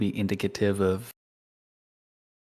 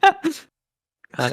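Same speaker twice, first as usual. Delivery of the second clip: high squeal through laughter; laughing; 6 dB louder than usual. Real, not acted. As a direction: crack up mid-word. Laughing hard at the game master.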